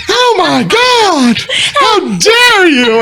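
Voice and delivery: put-on very high, drawn-out scandalized girl voice; mock outraged girl. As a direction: play her offended, stretching each word way up high. Imitating insulted girl at bar.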